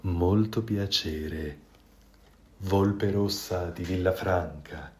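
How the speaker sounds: low, soft, very slow grand introduction; pompous fox bow. A narrator's reading. The fox shows the goose a noble greeting, slow and low. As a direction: introduce yourself like nobility.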